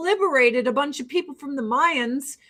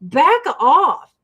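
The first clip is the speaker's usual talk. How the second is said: higher, louder mock telling-off; acted warning; 4 semitones higher, 7 dB louder than usual. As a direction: warn them away with force. Imagined telling off of critics.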